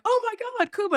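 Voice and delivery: high, excited imitated fans; giddy high-pitched reenactment; starstruck crowd. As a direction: squeal like fans spotting a star. Acts out crowd recognizing the star.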